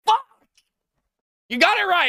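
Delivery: high, loud celebrating shout; excited shout. Real, not acted. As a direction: yell it out in triumph. Shouts at the correct answer.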